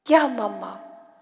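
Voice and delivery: eager answer. Acted. Josi answers mother.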